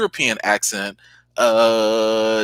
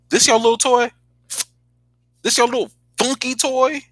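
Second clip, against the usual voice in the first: mocking taunt; 12 semitones higher than usual. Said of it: "that woman came out and was like".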